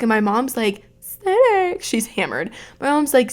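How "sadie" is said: high pitched sharp call of the name; scolding mom. Retold or imitated. Drunk mom scolding her daughter at the bar, high sharp call. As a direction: call her out like a shocked mother.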